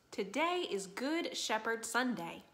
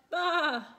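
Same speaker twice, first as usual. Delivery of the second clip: loud, high lamb "baa"; lamb's bleat; 6 semitones higher, 7 dB louder than usual. A narrator's reading. Scared lamb waiting for the shepherd, loud high bleat. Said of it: "he said".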